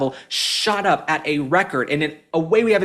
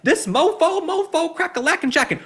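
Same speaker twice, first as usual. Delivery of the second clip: much higher, mocking put-on street voice; mimicking Jasmine Crockett's persona, much higher and mocking; mocking Crockett's camera persona; 11 semitones higher than usual. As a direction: lampoon her performative slang voice. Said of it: "Why do you talk like that when the camera comes on".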